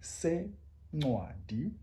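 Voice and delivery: Sencwadi very slow with the c click; isiXhosa click. Shown as a demonstration. Teacher modelling Sencwadi slowly. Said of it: "say it together".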